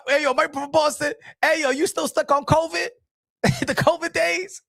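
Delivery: high, incredulous ribbing with laughs; laughing tease. Real, not acted. Host asks Mike if he's stuck on covid, laughing tease. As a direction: crack up as you needle a friend. Teasing a viewer between laughs.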